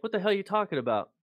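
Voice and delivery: sharp, fast and high, annoyed at being woken; irritated outburst. Retold or imitated. Corporal woken at night snaps at the buddy, fast and irritated. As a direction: snap back at a friend who woke you.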